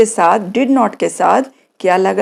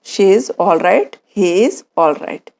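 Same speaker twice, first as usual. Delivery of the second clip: slow model answer; 3 semitones lower, much slower than usual. Shown as a demonstration. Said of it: "How is he?".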